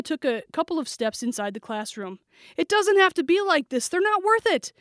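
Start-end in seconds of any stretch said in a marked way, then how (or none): 2.6-4.7 high, insistent appeal; urgent pleading. A narrator's reading. Girl talking the shooter down, urgent pleading. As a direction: plead with pitch way up.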